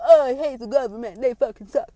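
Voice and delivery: loud imitation of a political complainer; mock outrage. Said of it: "then the other people".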